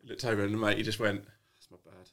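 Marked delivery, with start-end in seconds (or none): 1.5-2.1 whispered, barely voiced mock reply; breathy deadpan whisper. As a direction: breathe it out flat and soft. Mate's flat deadpan reply.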